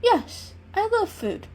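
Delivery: gleefully owning up to loving food; happy kitten voice. Acted. Kitten cheerfully admits.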